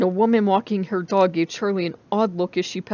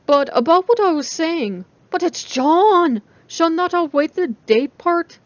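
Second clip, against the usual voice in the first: eager friend; 9 semitones higher, 6 dB louder than usual. A narrator's reading. Jessica pushing.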